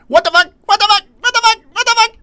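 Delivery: very high, loud squeaky cartoon voice. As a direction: shriek it in a tiny high-pitched voice. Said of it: "Make the voice again?".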